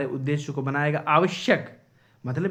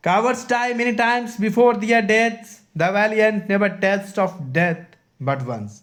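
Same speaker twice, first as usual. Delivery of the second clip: loud, high, slow declamation; Caesar; 7 semitones higher, 6 dB louder, much slower than usual. Acted. Teacher quoting Caesar's reply to his wife, loud slow declamation. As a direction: proclaim the line slowly and forcefully. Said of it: "तब जाकर के scissor कह रहा है".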